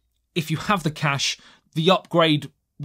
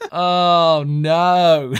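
amused dismay; 5 dB louder than usual. Real, not acted. Laughing dismay at own slip.

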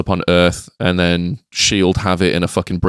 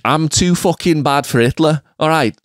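hammed-up Liverpool accent, higher-pitched threat; Red Skull; 6 semitones higher than usual. Liverpudlian villain threatens the Manchester hero, mock aggressive. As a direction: play a scrappy Scouse villain. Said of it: "Red Skull would be from Liverpool".

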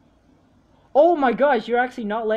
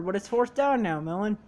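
ordinary talk

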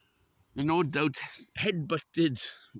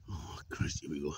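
whispering; 9 semitones lower, 7 dB quieter than usual. Real, not acted. Breathless climb, hushed.

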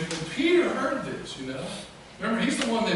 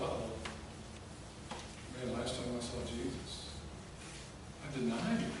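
low, soft, halting dread; sinking realization; 6 semitones lower, 9 dB quieter than usual. Acted. Running Peter remembering that he denied Jesus, sinking and low. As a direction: let it sink in with long pauses.

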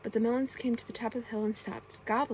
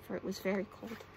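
plain reading voice

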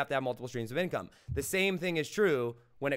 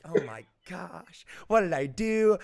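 retold panic; 4 semitones higher, 5 dB louder than usual. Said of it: "My heart is racing at a million miles an hour".

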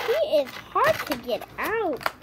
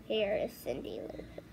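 whisper; 6 semitones lower, 12 dB quieter than usual. Real, not acted. Whispered reveal of toy.

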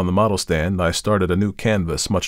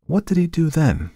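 pitched-up, curious question; 10 semitones higher than usual. A narrator's reading. Artist prompts his servant for more of the tale, curious. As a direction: ask with raised pitch, wanting more.

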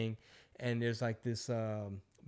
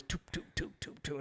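quick, soft, whispery patter of steps; light percussive mouth sounds, step by step; imitated footsteps; 2 semitones higher, 10 dB quieter than usual. Comedian coming down the stairs, imitated as soft footsteps. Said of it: "he starts coming down the stairs".

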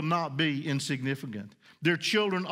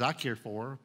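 God speaking tenderly; 5 semitones lower, 5 dB quieter than usual. Acted. God's voice, soft reply.

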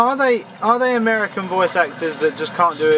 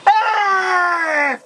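pained cry; 10 semitones higher than usual. Real, not acted. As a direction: cry out as you get hit. Getting hit in game.

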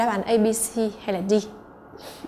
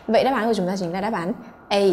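ordinary talk, nothing marked

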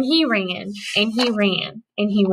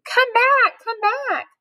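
put-on old couple yelling; 12 semitones higher, slower than usual. Old couple chasing behind, high yelling. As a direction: holler after the runaway. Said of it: "were yelling too".

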